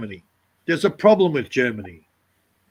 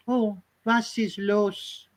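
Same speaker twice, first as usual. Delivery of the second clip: put-on slow, soft, higher "Oh, was ist los?" in a lilting German accent; soft Frenchified German; 5 semitones higher, 6 dB quieter, much slower than usual. Mocking New Age Germans, soft lilting German accent. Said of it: "the New Age Germans go".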